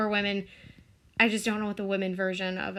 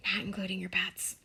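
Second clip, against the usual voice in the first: whisper; 4 semitones lower, 9 dB quieter, faster than usual. Real, not acted. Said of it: "whispered joke aside".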